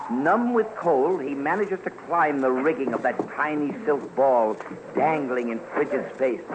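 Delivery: ordinary talk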